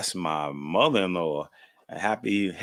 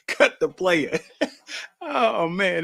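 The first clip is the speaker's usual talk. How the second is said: laughing; 10 semitones higher, slower than usual. Real, not acted. Laughing at funny family memory.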